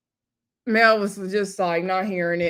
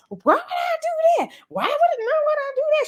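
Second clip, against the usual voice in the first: much higher put-on voice, indignant; indignant Mel. Retelling Mel denying it, high mock outrage. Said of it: "So Mel was like".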